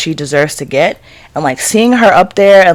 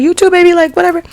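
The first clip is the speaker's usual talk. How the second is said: high, airy voice tossing it off; breezy celebrity; 12 semitones higher, faster than usual. Retold or imitated. Retelling Toot's goodbye, high breezy celebrity voice. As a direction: play the star, light and casual. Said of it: "she was like".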